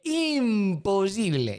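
"imposible" stretched slowly, each syllable dragged; drawn out. Shown as a demonstration. Vlogger on therapy prices, dragging out "imposible" slowly. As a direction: drag out "imposible" like it's absurd. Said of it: "repeats the word to stress it".

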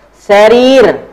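"Sarir" with the ri held long; long vowel. Shown as a demonstration. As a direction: stretch the second syllable of "Sarir", loudly. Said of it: "Nih ro-nya panjang ya".

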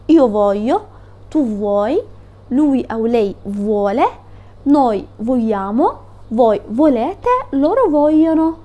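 slow, deliberate recitation; slow conjugation drill. Shown as a demonstration. Teacher reciting verb forms slowly for students. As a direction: lay out each form slowly so they memorize it. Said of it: "بحفظ التصريفات بتاعته".

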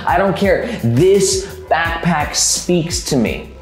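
ordinary talk, nothing marked

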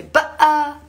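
loud high ba'a; playful boo. Shown as a demonstration. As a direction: jump out at a child. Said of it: "if you're scaring a child".

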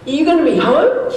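high, laughing imitation of the neighbour; amused retelling, laughing as he quotes. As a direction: giggle through the neighbour's question. Neighbour calls, retold laughing.

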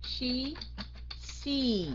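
"She" with a clear, held SH; SH sound demo. Shown as a demonstration. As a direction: round the lips and curl the tongue for "She". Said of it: "cái lưỡi cong lên".

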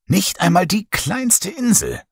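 plain reading voice

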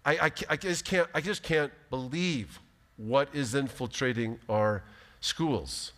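normal talking voice

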